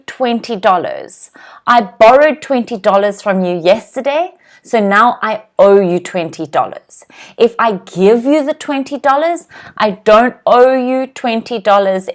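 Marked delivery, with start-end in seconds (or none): none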